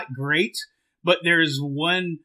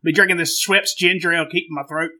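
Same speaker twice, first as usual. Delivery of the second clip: put-on Southern accent. Co-host chats about his ginger ale, Southern accent. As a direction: ramble like a comic Southern good old boy. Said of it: "to change accents".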